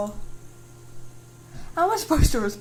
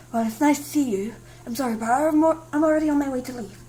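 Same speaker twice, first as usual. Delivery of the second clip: ordinary talk, nothing marked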